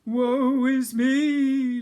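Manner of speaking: very slow, high, loud wail; moaning ghost. Acted. Ghost wails on the stairs, slow and high. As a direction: drag it out like a lost soul.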